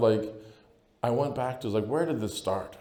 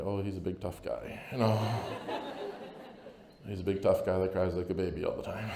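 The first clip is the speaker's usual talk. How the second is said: mocking onlookers; 4 semitones lower, 5 dB quieter than usual. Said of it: "people look at me and say".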